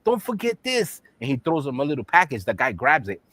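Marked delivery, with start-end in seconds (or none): 0.0-1.0 put-on high, urgent caricatured Chinese accent; mock Chinese accent. Shopkeeper calls back the customer, urgent mock Chinese accent. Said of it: "The Chinese man tells him".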